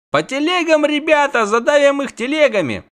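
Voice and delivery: very high, loud command; rally shout. Acted. Militiaman rallies the carts, high loud shout. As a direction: drive the men on loudly.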